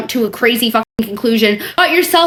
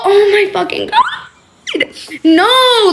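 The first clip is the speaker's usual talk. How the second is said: high, shocked outburst; outraged disbelief; 8 semitones higher than usual. Real, not acted. Outraged reaction.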